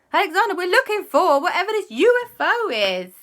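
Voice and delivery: loud, high-pitched correction; exasperated scolding. Acted. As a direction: set the child straight, out of patience. Exasperated adult corrects child in story.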